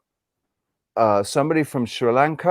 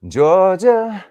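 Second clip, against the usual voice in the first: put-on Southern accent; 5 semitones higher, 4 dB louder, much slower than usual. Playfully drawing out another's home state.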